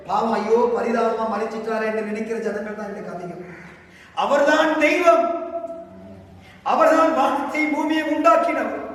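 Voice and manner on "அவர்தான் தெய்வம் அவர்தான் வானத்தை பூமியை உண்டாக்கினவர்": full-force preaching. Real, not acted. Preacher proclaims Jesus is God.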